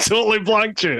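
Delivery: real laughing delight. Real, not acted. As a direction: say it while cracking up. Listener laughs at the snub.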